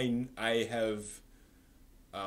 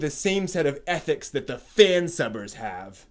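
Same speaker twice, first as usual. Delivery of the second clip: put-on loud, slow and higher, lecturing the kids; hammed-up holier-than-thou sermon; mock pompous reading; 5 semitones higher, 10 dB louder, slower than usual. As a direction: read it like a smug scold. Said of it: "someone has a chip on, on their shoulder".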